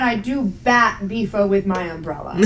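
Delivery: normal talking voice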